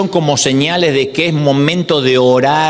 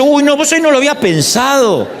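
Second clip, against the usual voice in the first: a much higher, laughing send-up of God; mock God voice; 6 semitones higher than usual. Preacher joking that God forgot their request, mock high laughing voice. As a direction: play God as a flustered partner, giggling.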